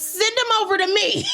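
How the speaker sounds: a delighted, squealing laughing shout. Real, not acted.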